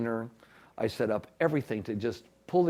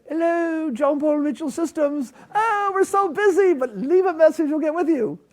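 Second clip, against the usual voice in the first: put-on high, loud, bright-sounding English accent for an answering machine greeting; 9 dB louder than usual. Friend recording the company answering machine message, in a high, loud English accent. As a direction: play a breezy English receptionist in an English accent, pitched well up. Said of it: "who had an English accent".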